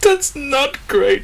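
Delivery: slow, high mascot lament; cartoon tiger taking bad news; dejected catchphrase. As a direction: draw out the slogan in mock disappointment. Sacked tiger's reversed catchphrase.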